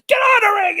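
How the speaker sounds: very high, loud yelp; mock yelled order. Jimmy jumping off the rig to take over, yelling. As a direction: yell it as an impatient command.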